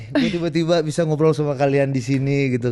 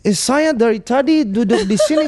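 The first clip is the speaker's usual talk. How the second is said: stiff foreigner's Indonesian, much higher and slow; Western expat accent; 7 semitones higher, slower than usual. Shown as a demonstration. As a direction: do the clumsy bule accent, drawn out. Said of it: "bahasa Indonesia logat bule".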